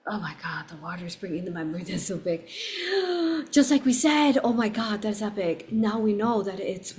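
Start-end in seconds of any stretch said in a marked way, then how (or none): 2.5-3.5 sharp high gasp of wonder; awed gasp. Real, not acted. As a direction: catch your breath in awe. Awe at returning memory.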